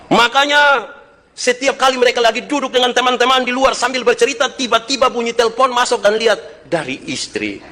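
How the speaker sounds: high-pitched, driving sermon delivery; fervent preaching. Acted. Preaches at full force.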